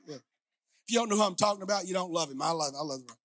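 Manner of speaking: normal talking voice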